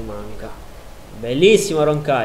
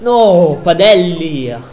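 loud exclamation; 5 semitones higher, 10 dB louder, much slower than usual. Real, not acted. Reacting to a chance in the match.

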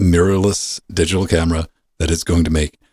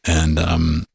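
ordinary talk, nothing marked

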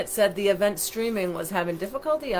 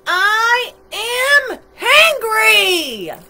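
very slow, high, loud shout; hangry monster roar; 12 semitones higher, 10 dB louder, much slower than usual. A narrator's reading. Monster bursting with hunger and anger, slow high shout. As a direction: bellow each word, stretched right out. Monster's outburst, grows bigger in story.

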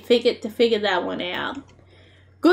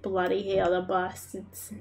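low-key Aussie bush cursing, softer; trailing-off bush Australian curse; Australian bush accent; 3 semitones lower, 8 dB quieter than usual. Shown as a demonstration. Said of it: "Bush accent".

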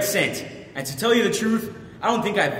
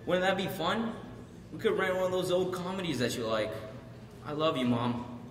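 hushed, much softer pleading; soft remorseful apology; 9 dB quieter than usual. Acted. Son apologising to his crying mom, soft and remorseful.

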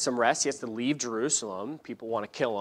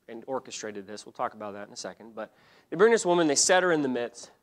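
ordinary delivery

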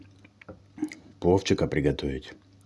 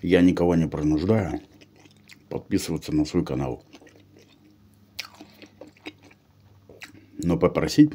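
talking with food in mouth; speaking between bites; chewing while talking; 3 dB louder than usual. Real, not acted. Seen 3: bit the snack at -4; cheeks and lips keep working while he talks.

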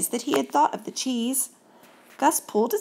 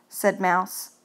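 ordinary delivery, nothing marked

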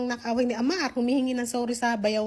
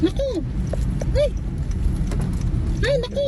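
short loud high exclamations; real fright at the storm; startled cries; 11 semitones higher, 6 dB louder, faster than usual. Real, not acted. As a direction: keep crying out in alarm. Reacting live to sandstorm.

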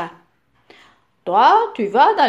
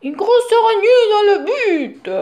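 much higher and louder warning cry, with a laugh; shouting; 10 semitones higher, 6 dB louder than usual. Acted. Papa warning his son about a spider in the goal, loud mock-alarmed cry. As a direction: yell the warning, pretending to panic. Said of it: "crie papa".

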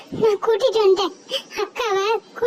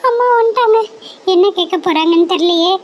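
put-on loud, fretful girl calling for her sister; a girl panicking that mother will scold her; loud anxious child voice, calling out; worried older sister; 4 semitones higher, 5 dB louder than usual.

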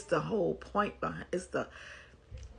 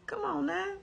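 exasperated plea; 13 semitones higher than usual. Real, not acted. Scolding the letter writer.

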